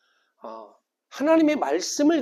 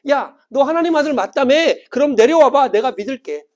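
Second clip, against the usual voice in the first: loud, high jeer easing off at the end; mocking taunt; 6 semitones higher, 8 dB louder than usual. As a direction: dare him with open scorn. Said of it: "야, 조롱하는 거예요". (큰 소리로 비아냥거리듯)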